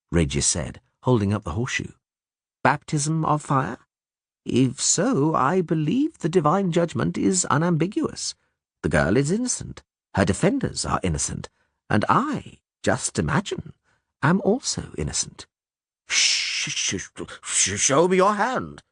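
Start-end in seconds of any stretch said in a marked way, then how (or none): 2.6-15.5 lightly voiced, unhurried Regis pleading innocence; measured barber surgeon. A narrator's reading. 16.1-18.8 halting, stuttered demand rising high; stammering mumble. A narrator's reading. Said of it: "the priest mumbled".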